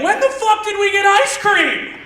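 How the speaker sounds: high, slow mock yell, laughing; yelled movie line. Friend yelling a movie line at a friend, high and slow. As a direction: bellow the quote way up high. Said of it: "be like".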